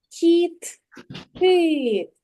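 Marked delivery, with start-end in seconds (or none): none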